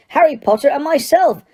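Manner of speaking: high-pitched, emphatic indignation; barked. A narrator's reading. Crouch names those wrongly accused, barking. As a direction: ring out each name indignantly. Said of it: "barked Mister Crouch".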